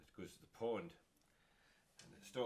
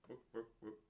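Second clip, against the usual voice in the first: chicken clucking; 4 semitones higher, slower than usual. Acted. Chicken in the story.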